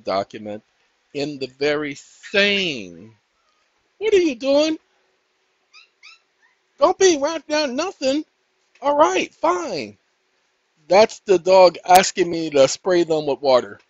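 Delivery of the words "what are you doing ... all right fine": high, bright pet talk; high pet-talk voice to the dogs. Real, not acted. Talking to his dogs.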